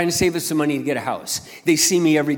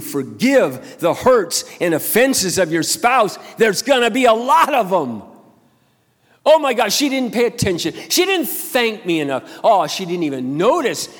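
higher and louder, building to a near shout; rising preaching; 4 semitones higher, 4 dB louder than usual. Acted. Pastor rails against keeping score of a spouse's offenses, rising loud. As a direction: rev up the congregation.

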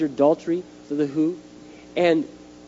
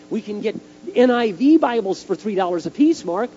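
high, louder insistent appeal; eager, raised-voice persuasion; earnest pitch; 6 semitones higher, 5 dB louder than usual. Retold or imitated. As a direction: press your case to a colleague. Acts out pitching the pastors.

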